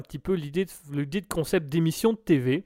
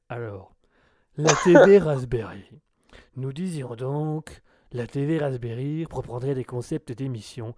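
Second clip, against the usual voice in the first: sultry narrator; 5 semitones lower than usual. Acted. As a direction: purr it smoothly and low. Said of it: "avec ma voix suave".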